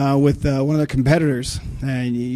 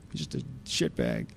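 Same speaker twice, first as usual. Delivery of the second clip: dropped low and much softer, a deadpan aside; inside voice; 13 semitones lower, 14 dB quieter than usual. Real, not acted. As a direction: mutter it under your breath, lower and quieter. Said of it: "did I use my out-, outside voice again?".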